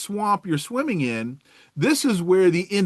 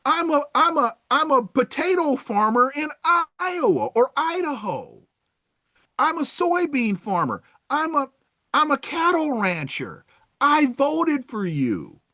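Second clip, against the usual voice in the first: mock farmer voter; 6 semitones higher than usual. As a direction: list your jobs like a wounded supporter. Voter persona continues.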